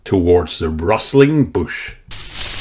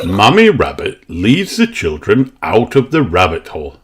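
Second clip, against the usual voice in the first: ordinary delivery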